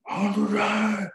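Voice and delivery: high, drawn-out mock yell held back in volume; imitated strained wail. Retelling Carl insisting on driving at night, imitated wail. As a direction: whine it out slowly, way up high.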